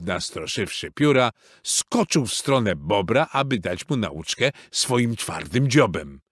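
ordinary delivery

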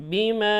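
বিমা with the ma stretched long and loud; elongated madd. Shown as a demonstration. Teacher modelling the long madd in বিমা. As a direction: pull out the alif in বিমা for three counts. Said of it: "extra টানতে হবে".

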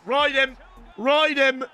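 high-pitched cheering on; urging shout. Real, not acted. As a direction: egg the player on. Urging a player on mid-game.

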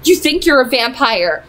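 ordinary delivery, nothing marked

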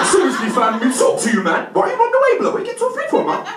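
high, loud hammed-up youth; insistent street youth. Youth calling after him as he runs away, high insistent shout. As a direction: call after him, pitch climbing sharply. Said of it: "He's like".

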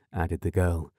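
ordinary delivery, nothing marked

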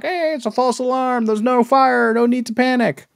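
high, chipper reassuring call; mock captain announcement. Ship captain calming panicking guests, high chipper voice. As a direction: announce it brightly to calm the crowd.

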